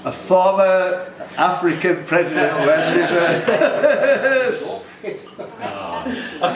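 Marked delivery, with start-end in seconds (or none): 5.0-6.4 silent wheezing laugh; breathy laugh. Real, not acted. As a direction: laugh without voice. Host cracks up.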